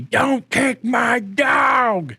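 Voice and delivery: high, drawn-out shriek of an outraged dog owner; hammed-up high-pitched wail; mock scream. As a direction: screech it slowly, imitating her screaming. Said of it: "she starts screaming at me".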